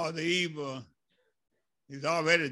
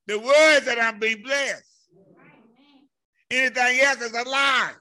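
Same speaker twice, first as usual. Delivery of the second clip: loud pulpit declaration; preacher hammering key words loudly; emphatic preaching; 8 semitones higher, 10 dB louder than usual. Acted. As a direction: declare it firmly to the congregation. Preaches at full force.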